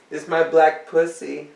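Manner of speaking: ordinary delivery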